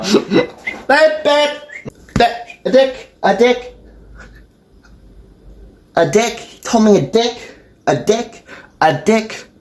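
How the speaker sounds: repeated high-pitched involuntary blurts; vocal tics. Real, not acted. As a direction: yelp each phrase out abruptly, high. Burst of involuntary verbal tics.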